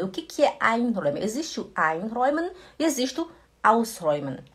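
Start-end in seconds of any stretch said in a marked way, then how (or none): none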